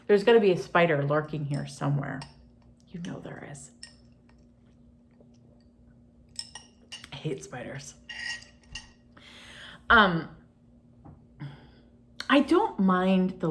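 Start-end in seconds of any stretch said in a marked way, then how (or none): 2.9-7.9 whisper. Real, not acted. Whispered creepy spider aside.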